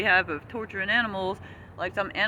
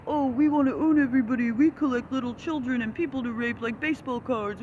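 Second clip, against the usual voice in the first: higher, mock-greedy sneer of the rich; mocking the elites; 4 semitones higher than usual. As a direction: sneer like a collector of people. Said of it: "they think".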